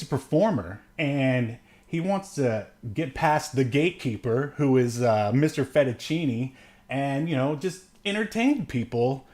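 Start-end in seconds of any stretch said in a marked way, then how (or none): none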